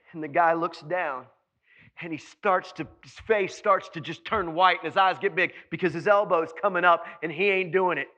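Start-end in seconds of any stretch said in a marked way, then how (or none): none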